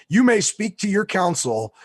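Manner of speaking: a touch of a loud stern judge; judge granting. Retold or imitated. Judge allows the defendant counsel, mock stern ruling. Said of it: "He goes".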